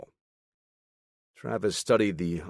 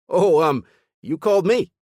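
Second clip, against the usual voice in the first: chipper, animated; 11 semitones higher, 9 dB louder than usual. Acted. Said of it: "chipper and animated".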